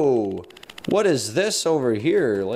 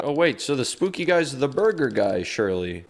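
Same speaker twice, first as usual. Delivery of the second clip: ordinary delivery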